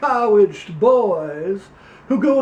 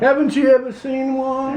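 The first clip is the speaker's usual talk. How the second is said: put-on Louisiana accent; 2 semitones higher, faster than usual. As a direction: play a pompous Louisiana candidate, Southern accent. Same character continues.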